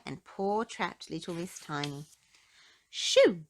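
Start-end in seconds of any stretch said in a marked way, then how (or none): 2.9-3.4 shooing. A narrator's reading. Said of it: "said Mister Strong to Ginger".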